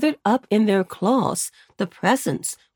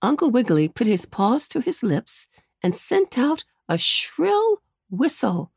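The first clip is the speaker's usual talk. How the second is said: usual speaking voice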